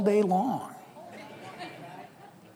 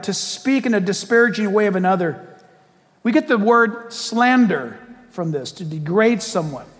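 usual speaking voice

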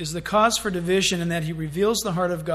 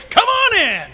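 joyful welcome; 11 semitones higher, 9 dB louder, slower than usual. Acted. Angels welcoming into heaven.

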